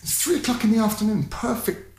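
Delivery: high, fast incredulous pleading; half-acted disbelief at a reckless driver; exasperated plea. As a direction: plead with him, pitch up and rush it. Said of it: "I'm like".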